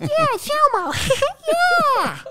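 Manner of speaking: loud, very high puppet falsetto; Elmo. Acted. Showing off the kids' puppet voice, loud squeaky Elmo. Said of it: "Just like Elmo".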